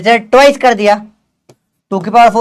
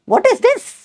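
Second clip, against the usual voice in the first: incredulous; much slower than usual. Real, not acted. Teacher reacting to wrong answers.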